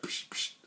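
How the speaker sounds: voiceless punch whooshes; quick puffed noises for flying fists; imitated punch sounds. Storyteller showing the opponent's punches, hissed sound effects.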